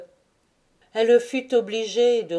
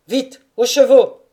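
loud, urgent military command; shouted order; 5 semitones higher, 12 dB louder than usual. Acted. Officer orders his soldier to the horses, urgent shout. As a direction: shout the men into action.

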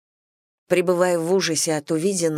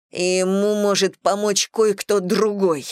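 usual speaking voice

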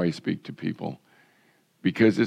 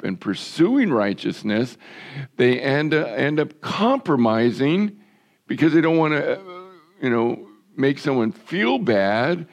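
raised, pushing sermon voice; fervent preaching; 3 semitones higher than usual. Acted. Pastor urging his congregation to stand for righteousness, preaching hard. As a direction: press the point home to the flock.

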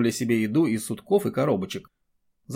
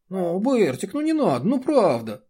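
very high, wheedling plea; fawning; 7 semitones higher than usual. A narrator's reading. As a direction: grovel and coax her in a squeaky-high voice. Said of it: "залебезил скрипач".

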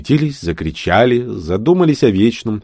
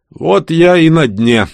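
slow, raised mock-tragic wail; hammed-up despair at hitting bottom; tragic lament; 8 semitones higher, 6 dB louder, slower than usual. Pifutsiy laments sinking to the bottom, mock tragic. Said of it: "трагически произнёс Пифуций".

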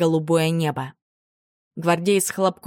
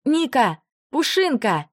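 high frightened cry; 6 semitones higher than usual. A narrator's reading. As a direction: call out in fear. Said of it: "вскрикиваю в страхе".

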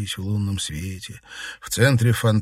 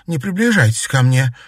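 high, loud warning; alarmed, raised voice; frightened cry; 5 semitones higher, 7 dB louder than usual. A narrator's reading. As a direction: cry out in fright to keep them back. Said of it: "испуганно вскрикнула она".

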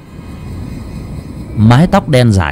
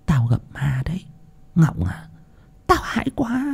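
faint, breathless whisper; barely able to speak after fainting; exhausted whispered confession; weary frightened whisper; 5 semitones higher, 9 dB quieter than usual. Acted. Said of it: "Bà Phú mệt mỏi".

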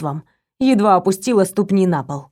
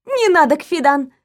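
curt and sharp refusal; 6 semitones higher than usual. A narrator's reading. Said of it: "отреагировала я гораздо резче".

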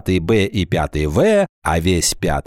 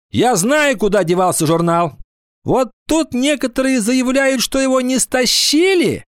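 high, solemn schoolboy announcement; self-important. A narrator's reading. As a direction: make a grand revelation to the class. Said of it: "так важно и так торжественно". (важно и торжественно)

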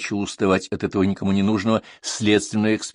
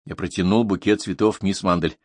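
ordinary delivery

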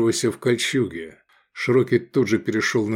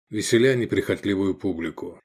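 ordinary delivery, nothing marked

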